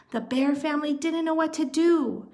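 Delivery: plain reading voice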